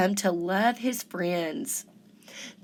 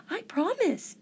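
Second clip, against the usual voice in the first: much higher and softer, earnest; little crab promising; 10 semitones higher, 4 dB quieter than usual. A narrator's reading.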